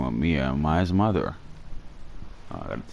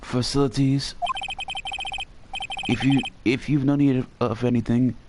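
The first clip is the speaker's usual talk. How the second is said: put-on higher, polite old-fashioned bellboy; Alfred voice; 6 semitones higher than usual. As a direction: play an eager, chirpy butler-type bellhop. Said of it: "So I'm gonna do the Alfred voice".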